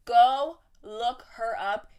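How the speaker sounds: slow, loud, word-by-word command; insistent, spaced-out order; emphatic insistence. Real, not acted. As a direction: stretch and punch GO, then LOOK HER UP. Emphatic repeat of instruction.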